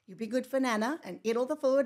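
put-on loud call to the kids. Mother calling out loud to her kids as she leaves. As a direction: call it across the room to the kids.

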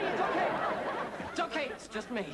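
high, startled, hurried reassurance; calm her down while panicking yourself; frantic reassurance. Acted. Man who startled a screaming woman, frantic reassurance.

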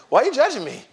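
loud, high-pitched mock protest; mock indignation. Preacher to judging audience, loud mock indignation. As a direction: playfully cry out against being judged.

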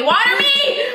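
desperate pleading for water, rising high; begging. Real, not acted. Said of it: "I wanna see you beg".

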